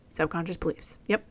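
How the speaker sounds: usual speaking voice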